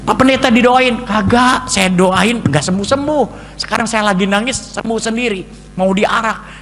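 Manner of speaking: high, loud excited villagers; excited uproar. Retold or imitated. Whole village in uproar, loud excited shouting.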